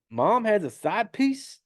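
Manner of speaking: high, slow mock disbelief; retold incredulous question. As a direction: imitate a stunned daughter asking. Retold discovery about mom.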